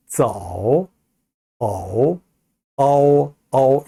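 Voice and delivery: 쩌우 and 어우 drawn out from eo to oo; correct model. Shown as a demonstration. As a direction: model 어우 with an unrounded start, gliding into oo. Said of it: "이렇게 발음해야 되는 발음이에요".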